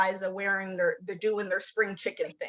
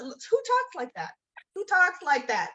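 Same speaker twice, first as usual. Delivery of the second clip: higher-pitched mock disbelief; incredulous retort; 6 semitones higher than usual. Daughter-in-law answering a threatened curse, incredulous. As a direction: question it in raised, incredulous pitch.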